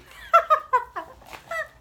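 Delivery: very high loud squeal of laughter. Real, not acted. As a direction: shriek with laughter. Laughing at own joke.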